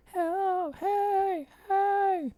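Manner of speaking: high, calling help from far off; distant cry for help. Retold or imitated. Someone on the beach calling for help, high and far. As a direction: call out as if from the distance. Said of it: "and I hear".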